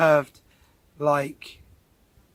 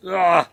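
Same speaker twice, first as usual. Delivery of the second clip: disgusted cry; 4 semitones higher, 9 dB louder than usual. Retold or imitated. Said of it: "and it's like".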